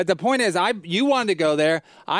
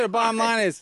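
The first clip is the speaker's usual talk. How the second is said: ordinary talk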